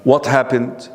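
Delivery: usual speaking voice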